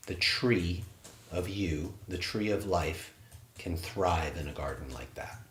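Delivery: soft, calm guided-meditation voice. Acted. Instructor guiding students through closing, soft meditation voice. Leads qigong cool-down.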